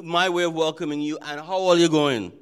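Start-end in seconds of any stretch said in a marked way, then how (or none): 1.4-2.3 Trinidadian greeting. Shown as a demonstration. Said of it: "Trini and Jamaican".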